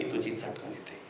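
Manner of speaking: choked whisper of grief. Real, not acted. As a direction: let the words fall to a whisper. Voice breaks into whisper.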